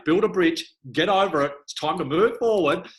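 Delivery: a hint of a stern mum telling her off; mum's blunt advice. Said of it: "my mum would say".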